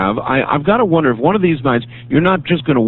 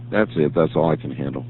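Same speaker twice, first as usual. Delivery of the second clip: drops low and soft, worn out; quiet exasperation; 12 semitones lower, 7 dB quieter than usual. Real, not acted. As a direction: sigh it out, fed up. Host exhausted after ranting caller.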